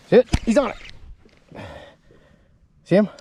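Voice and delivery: high, loud excited burst; thrilled, pointing it out. Real, not acted. Excited, watching fish on graph.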